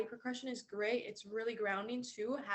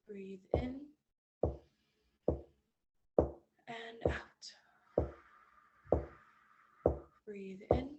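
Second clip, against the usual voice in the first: guided breathing. Acted. Guiding slow breaths in and out.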